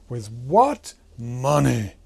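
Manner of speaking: WHAT and MONEY punched loud and drawn out; slow, loud stress on WHAT, then a stretched MONEY; emphasized words. Retold or imitated. Animator copies the actor's retort, heavy stress on WHAT and MONEY. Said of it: "had a reason to emphasize these words".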